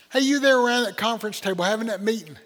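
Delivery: loud call across to a busy group. Acted. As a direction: hail them loudly, as God interrupting. Acted God calling out to meeting.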